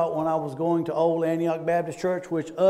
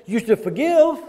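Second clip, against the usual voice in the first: quoting the church; 6 semitones higher, 7 dB louder than usual. Retold or imitated.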